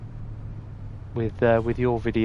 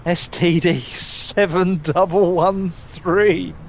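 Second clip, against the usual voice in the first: slow, laughing read-out; 4 semitones higher, slower than usual. Real, not acted. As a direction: read it out through real laughter. Laughing while reading number plate.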